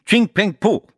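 high, loud bark of mock Chinese; spitting, explosive outburst; snapped angrily. A narrator's reading. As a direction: snap at someone to shake him off. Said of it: "schnauzte ihn an, dass Speicheltröpfchen flogen".

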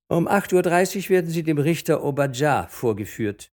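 loud, high official order; policeman's announcement. A narrator's reading. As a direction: bark out the summons like a policeman.

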